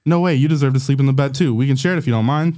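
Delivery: usual speaking voice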